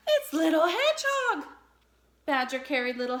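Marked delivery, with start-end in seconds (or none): 0.1-1.5 surprised. A narrator's reading. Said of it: "he said in surprise".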